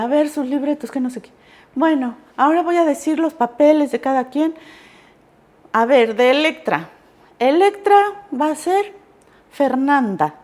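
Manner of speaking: name the cast like the teacher; teacher announcing. Retold or imitated. Teacher announcing roles, retold.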